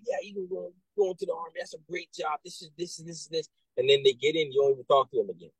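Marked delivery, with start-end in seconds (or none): 0.0-3.5 airy, higher and much softer sales pitch; breezy recruiter brushing the kid along, half-whispered; mock slick recruiter. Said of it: "the recruiter that would say".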